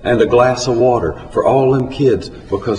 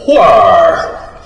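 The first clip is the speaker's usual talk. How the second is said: loud, drawn-out, falling exclamation; indignant outburst; 4 semitones lower, 6 dB louder than usual. A narrator's reading. Cafe owner answers a preacher asking for a discount, indignant. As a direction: let one word out loud and long, dropping in pitch.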